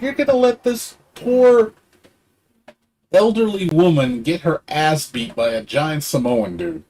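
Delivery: high-pitched, incredulous outrage; indignant scolding. Retold or imitated. Berating bystanders who let a woman be beaten, indignant.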